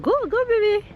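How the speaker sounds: high, loud cheering. Real, not acted. Cheering toddler at park.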